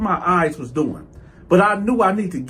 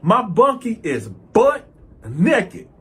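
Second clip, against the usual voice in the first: ordinary delivery, nothing marked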